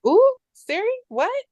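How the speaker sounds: high-pitched scandalized reaction; mock shock. Viewer to Siri talking back, high mock shock. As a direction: react as if taken aback by sass.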